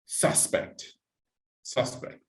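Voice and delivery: "suspect" softly, stressed on the first syllable. Shown as a demonstration. Teacher shows the noun for the person, "suspect" stressed at the start. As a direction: model the noun "suspect", weight on its beginning. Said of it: "the stress is at the beginning".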